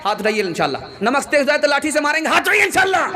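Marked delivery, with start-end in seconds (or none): 1.0-3.1 rousing call. Real, not acted. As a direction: whip the audience up to raise hands. Rousing the crowd to raise hands.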